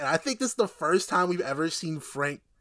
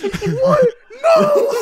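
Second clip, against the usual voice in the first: high, loud hammed-up shriek of panic; mock shout; 13 dB louder than usual. Mimicking the doomed man's panicked cry, laughing. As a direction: yell it like a cornered man. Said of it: "The dude goes".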